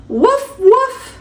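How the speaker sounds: high, yippy little puppy bark; squeaky cartoon puppy woof. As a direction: bark like a tiny playful puppy. Puppy barking in story.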